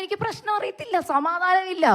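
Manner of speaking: high, loud, near tears; distressed cry. Retold or imitated. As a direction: cry out your helplessness to mom. Retelling distress to her mother. (ഉയർന്ന ശബ്ദത്തിൽ കരച്ചിലോടെ)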